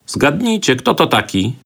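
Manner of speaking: put-on high, lively child's voice; cheerful little voice. A narrator's reading. Boy playing guess-who with his friends, high and cheerful. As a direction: pipe it up brightly and playfully. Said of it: "ozwał się głosik rzeźwy, wesoły, radosny".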